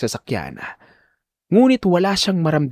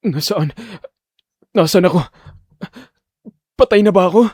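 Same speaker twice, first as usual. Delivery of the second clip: rising panic, growing louder to the last question; confused fear; 5 semitones higher, 3 dB louder than usual. Acted. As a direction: ask in mounting alarm. Waking confused after the crash.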